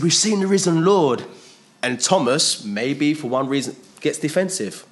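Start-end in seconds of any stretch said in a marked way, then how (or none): 0.0-1.2 raised, loud and eager; high, loud excited testimony; excited report. Retold or imitated. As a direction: burst in with the good news. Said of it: "really excited and everything".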